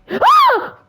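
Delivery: high piercing scream of real fright; startled scream. Real, not acted. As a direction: shriek in sudden terror. Jump scare hits streamer.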